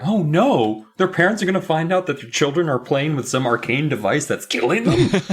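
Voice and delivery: hammed-up horror, climbing in pitch; mock alarm. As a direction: build from worry to a high-pitched finish. Mock horror at non-cliffhanger.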